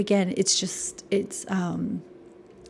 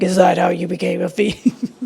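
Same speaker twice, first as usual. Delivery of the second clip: loud mock-condescending jab; imitating a snippy princess; 8 dB louder than usual. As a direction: sneer the question down at him, louder. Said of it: "kinda condescending".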